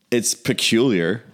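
usual speaking voice

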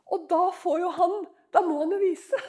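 usual speaking voice

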